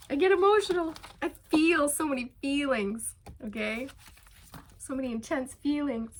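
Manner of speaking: pushing through happy tears; emotional. Real, not acted. Telling viewers about her feelings, teary. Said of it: "I'm getting emotional again".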